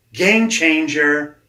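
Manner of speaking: loud, high, drawn-out proclamation; emphatic revelation. Real, not acted. Coach announcing his breakthrough to the host, emphatic. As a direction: stretch both words out big.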